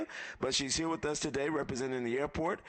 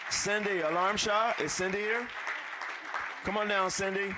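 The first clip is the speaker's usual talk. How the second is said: game show call-up; 6 semitones higher than usual. Real, not acted. Emcee calling up sponsor.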